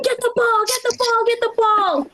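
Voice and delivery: high-pitched imitation of a yelling grandfather; bellow-style sideline orders, without real volume; mock yelling. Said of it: "he'd be, like, screaming".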